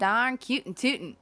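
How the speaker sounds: slow, hammed-up Southern twang; southern accent. Shown as a demonstration. Showing off for friends, slow Southern accent. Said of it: "I can do a southern accent".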